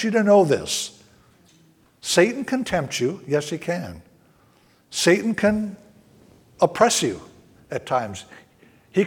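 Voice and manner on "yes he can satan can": ordinary talk, nothing marked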